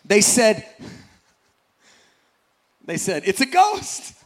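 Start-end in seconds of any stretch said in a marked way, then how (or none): 3.2-4.1 high, frightened cry of sailors seeing a ghost; terrified cry. Acted. Frightened disciples on the boat cry out, high and scared. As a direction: gasp it out like you've seen a ghost. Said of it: "They cried out in fear".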